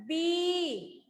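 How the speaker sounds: "बी" with the ee held long; long ee vowel. Shown as a demonstration. Teacher modelling the matra for students, long-drawn "बी". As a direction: stretch out the vowel of "बी". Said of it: "लंबा होता है ना".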